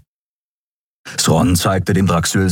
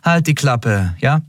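higher-pitched, offhand rebuke; bored; 7 semitones higher than usual. A narrator's reading. Captain silences grumbling crewman, bored. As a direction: dismiss him with mild boredom. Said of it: "gelangweilt und ohne sich umzudrehen".

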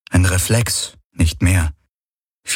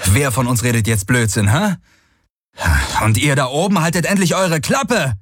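annoyed, rising to a higher-pitched shout; irritated yelling; 7 semitones higher than usual. Acted. He shouts at the screeching creatures above, irritated yelling. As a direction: snap back, then yell up at them. Streit, ruft zu den Krächzern hinauf.

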